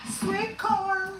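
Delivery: very high squeaky voice for the can; cartoon can voice; talking can voice.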